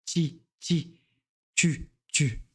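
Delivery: "chi" and "tu" with T released into a small ch; slight ch after T. Shown as a demonstration. Teacher modelling a little ch, "chi" as tchi, "tu" as tchu. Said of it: "un petit ch".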